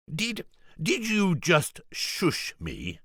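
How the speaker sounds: can't believe he was shushed; affronted disbelief. Acted. George affronted at being shushed.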